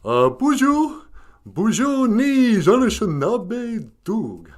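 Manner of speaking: high, loud goofy talking dog; goofy dog character. Pretend. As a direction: play an excitable, goofy dog puppet. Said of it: "you know, goofy of the two".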